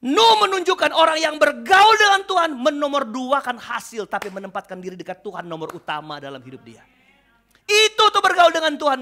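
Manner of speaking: loud preacher's proclamation; fervent preaching. Acted. Pastor urging the congregation, loud preaching. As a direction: proclaim it to the pews.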